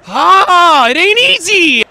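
high, booming mock-cheer yell; loud outburst. Real, not acted. Gamer yells at game setback.

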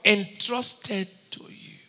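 slow, hushed whisper. Acted. Preacher drops to a slow whisper for the congregation. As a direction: breathe the words out slowly.

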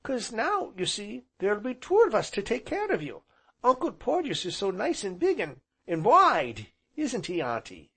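put-on high-pitched eager child chatter; small boy. A narrator's reading. Small boy praises uncle to aunt, high and eager. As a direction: rattle on high like a little boy.

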